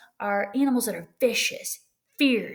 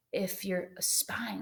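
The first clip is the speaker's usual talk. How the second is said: slow, hushed spy whisper; sneaky whisper; 9 dB quieter, much slower than usual. Shown as a demonstration. Teacher acts out a sneaky spy, slow whisper. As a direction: breathe it like a snoop.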